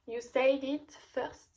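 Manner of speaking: slow English with a French accent; English words, French accent. Real, not acted. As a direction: read the English title carefully in a French accent. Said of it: "pour mon accent".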